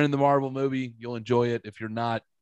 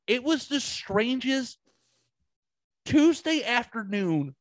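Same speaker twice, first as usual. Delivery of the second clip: strangest stretched slowly and pitched way up; exaggerated slow disbelief, voice pitched up; drawn-out emphasis; 11 semitones higher, much slower than usual. Real, not acted. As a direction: lean hard on strangest, slow and high. Stressing how strange the encounter was.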